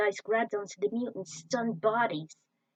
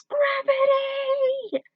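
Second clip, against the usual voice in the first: screamed word; 7 dB louder, much slower than usual. Acted.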